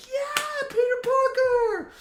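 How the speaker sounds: high, loud drawn-out whoop; amped-up moviegoer yell; imitated audience cheer. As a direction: cheer like a fan greeting a hero. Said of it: "every time a character was introduced".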